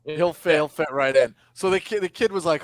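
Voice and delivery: ordinary talk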